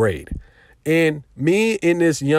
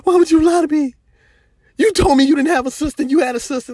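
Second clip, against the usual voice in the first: put-on high, whiny wronged-girlfriend voice; playing the victim; 12 semitones higher, faster than usual. Mocking the girl who slapped him, high whiny victim voice. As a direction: mimic her acting hurt and betrayed. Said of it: "turned into a victim and said".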